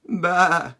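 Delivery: short, high, loud bah; sheep baa. Pretend. Sheep fact finished for the kids, one loud bleat.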